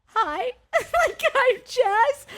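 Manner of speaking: very high, squeaky introduction with a laugh; bewildered greeting. Retold or imitated. Woman introducing herself to suddenly friendly cast, bewildered.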